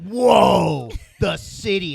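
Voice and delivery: loud, high, wide-eyed "whoa" at a suburb; mock awe. Teen mocking his younger self with a loud, high, awestruck shout. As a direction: ham up a kid's amazement at the big city.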